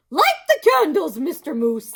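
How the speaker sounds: high, loud, gushing hostess; Missus Moose voice. Acted. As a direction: gush at him, high and bright.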